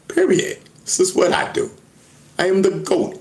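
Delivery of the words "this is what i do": ordinary talk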